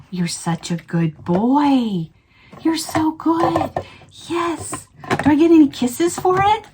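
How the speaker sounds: slow, high doting pet voice; talking to a dog. Real, not acted. Praising the dog.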